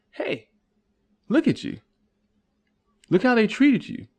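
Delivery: high, insistent coaxing voice; persuader. Acted. Manipulator urging the listener to feel mistreated, high and coaxing. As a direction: needle him into feeling wronged.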